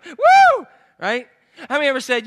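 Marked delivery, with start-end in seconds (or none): 0.0-0.7 loud, high "woo"; mock celebratory whoop. Boaster celebrates his lusting, loud mock whoop. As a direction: whoop as if proud.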